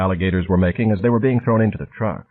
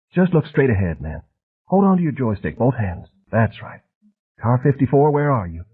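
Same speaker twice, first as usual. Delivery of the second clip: lightly higher, soft, a touch quick and wired; soft jabbering hustler; 4 semitones higher than usual. A narrator's reading. Harvard man threatening Flint at the urinal, soft and wired. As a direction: menace wrapped in a soft, chatty voice. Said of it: "jabbering as if he might be flying on speed".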